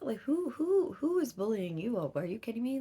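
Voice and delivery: high, loud outraged protective mom. Retold or imitated. Mother outraged hearing her daughter is bullied, loud and high. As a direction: demand who hurt your child.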